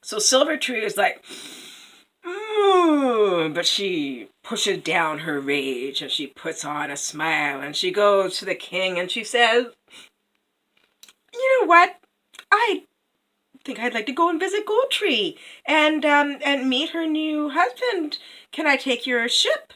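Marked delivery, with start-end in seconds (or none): 2.2-3.5 high, loud frustrated hum; hammed-up suppressed fury; stifled rage. Queen holds in rage. 11.3-19.7 very high, sugary put-on voice; falsely sweet queen. Queen hides rage, coaxes king.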